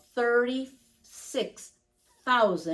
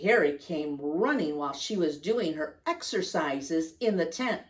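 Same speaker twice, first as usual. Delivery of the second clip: ordinary delivery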